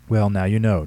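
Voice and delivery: loud, pretend-stern scolding; officer to child, a mock-stern warning; mock sternness. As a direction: scold the little girl but don't mean it. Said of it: "with mock sternness".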